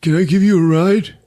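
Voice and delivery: high-pitched, put-on nice-guy voice; Anthony LaPaglia, nice. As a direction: lay on the friendliness in a high mock voice. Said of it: "he's all nice to her".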